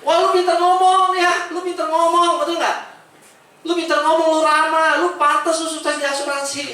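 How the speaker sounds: high, loud, gushing mock praise; mock flattery. Speaker mocks people who now flatter his success, high gushing praise.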